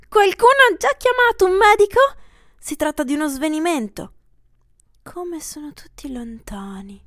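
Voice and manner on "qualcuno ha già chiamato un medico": high, loud anxious voice; a worried guest calling out; anxious bystander. Acted. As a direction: ask around in alarm. Bystander calls out.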